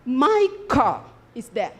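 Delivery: slow, proud "My car is there."; proud attitude. Shown as a demonstration. Teacher showing students how proud owners speak, slow and proud. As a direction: show off the car, each word savoured. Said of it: "They say it with an attitude".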